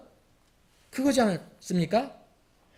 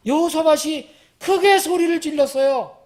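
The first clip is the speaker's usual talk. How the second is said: high, raised preaching voice; emphatic loud retelling; 9 semitones higher, 6 dB louder than usual. A narrator's reading. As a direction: proclaim it loudly, leaning on 크게. Preacher re-enacts the loud cry emphatically. (크고 높게 강조하는 설교조)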